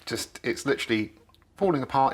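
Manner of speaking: ordinary talk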